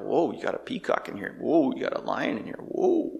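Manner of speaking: hushed imitation of awed onlookers; mock amazement. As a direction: play an impressed bystander, softly. Imitates onlookers amazed at the king's animals.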